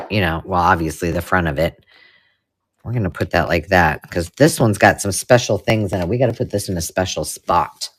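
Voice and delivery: ordinary talk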